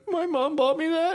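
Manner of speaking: put-on high-pitched, slow kid whine; hammed-up crying complaint; mock tearful whine. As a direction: wail it like a heartbroken child. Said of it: "I was a crier".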